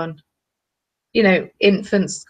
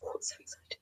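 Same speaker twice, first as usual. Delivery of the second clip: very soft whispered thrill; 23 dB quieter, faster than usual. Real, not acted. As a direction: whisper your secret joy. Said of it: "intensely excited".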